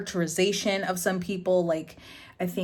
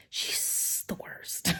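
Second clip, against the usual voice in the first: whispered gripe; 9 dB quieter, much slower than usual. Real, not acted. Whispering to viewers about reading buddy Lynette, hushed gripe. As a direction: whisper it, slow and secret.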